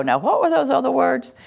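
high, puzzled self-question; a mildly hammed-up puzzled question; mock confused. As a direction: play yourself groping for words. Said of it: "you go".

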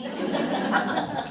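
soft breathy laugh; a quiet amused chuckle. Real, not acted. Laughs after mock groan.

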